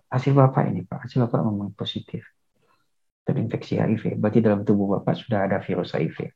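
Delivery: soft, calm confirmation; gentle counselor. Acted. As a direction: break the news softly and steadily.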